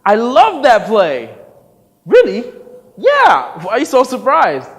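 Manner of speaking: loud, high, slow delight; enthusing. Acted. Director gushing about the play, loud and high.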